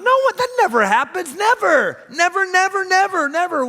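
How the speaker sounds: NEVER hammered high and loud on every repeat; emphatic never. Real, not acted. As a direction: pound each never harder. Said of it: "emphatic repeated denial".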